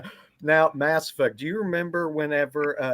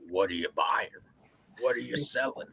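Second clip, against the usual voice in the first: game merchant impression; 6 dB quieter than usual. Quoting the Resident Evil merchant to his girlfriend, lightly voiced. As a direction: voice the game shopkeeper greeting. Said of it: "he'd be going".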